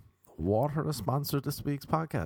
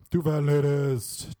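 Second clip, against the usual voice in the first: loud bingo call; 5 dB louder than usual. Pretend.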